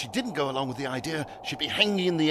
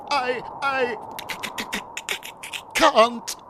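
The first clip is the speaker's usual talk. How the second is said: high, stuttering and scared; stammering; 8 semitones higher than usual. A narrator's reading. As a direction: stammer out each c-. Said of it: "he stammered".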